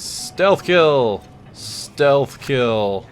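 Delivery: big game-show style shout-out; mock triumphant announcement. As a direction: call it out like a prize being won. Hyping the game's prize move.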